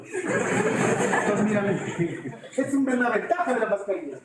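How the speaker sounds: chuckling through the words; amused laugh running through the line. Real, not acted. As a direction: laugh at your own joke while talking. Teacher laughs at own joke.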